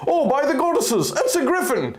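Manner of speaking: high, startled guard cry; shocked guard. Acted. Guard Palin seeing the griffin, high shocked cry. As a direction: blurt it out in pure shock. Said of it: "he cried".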